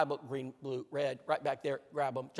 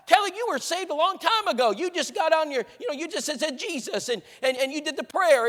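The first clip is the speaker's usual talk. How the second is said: high-pitched, scolding mother imitation; screaming mother; 8 semitones higher, 5 dB louder than usual. Mother challenging daughter about her Bible learning, screaming. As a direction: rail at her in mock outrage, stumbling over words. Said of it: "her mom's screaming at her".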